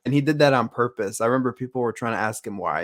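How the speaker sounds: usual speaking voice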